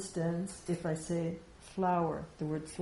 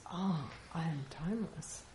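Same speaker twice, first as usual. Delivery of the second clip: soft, hushed dawning recognition on "Ah, I am timeless"; quiet realization; 7 dB quieter than usual. Shown as a demonstration. Teacher voicing a student's quiet moment of realization. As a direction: let it land softly, as a discovery. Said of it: "that makes you recognize".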